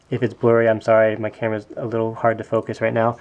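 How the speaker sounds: usual speaking voice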